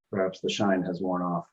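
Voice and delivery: ordinary talk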